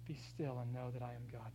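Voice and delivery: low, soft, still voice; hushed calm. Acted. Preacher quoting God calming the shaken psalmist, low and hushed. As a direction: settle everyone into stillness.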